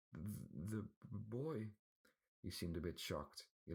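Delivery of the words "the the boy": stuttering servant. A narrator's reading. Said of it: "stuttering man".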